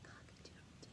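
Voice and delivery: a tiny breathless whisper of a crow. Acted. Rooster losing his voice, whispered crow. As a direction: rooster trying to crow but only whispers. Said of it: "only a whisper came out".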